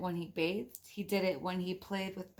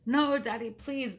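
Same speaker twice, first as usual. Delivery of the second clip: put-on a touch of childish pleading, louder; begging; 4 semitones higher, 7 dB louder than usual. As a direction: plead with daddy, lightly voiced kids. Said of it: "begged Betty and Billy".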